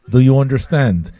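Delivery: ordinary delivery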